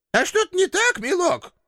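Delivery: put-on shrill-free high old woman's rebuke; old landlady. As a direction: shoo him off like a cranky granny. Landlady mocks hero.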